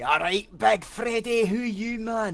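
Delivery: high, loud, slow Scottish hail to a mate; broad Scottish greeting. Acted. Greeting his friend at the door, loud broad Scottish hello. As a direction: clap him on the back and greet him in broad Scots. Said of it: "the Scottish equivalent".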